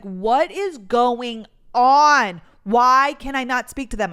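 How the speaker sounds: loud, drawn-out exasperated outburst. Retold or imitated. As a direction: stretch the words out loudly in frustration. Retold exasperated outburst at unreachable company.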